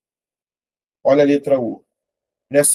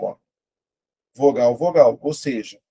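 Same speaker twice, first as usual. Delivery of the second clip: ordinary talk, nothing marked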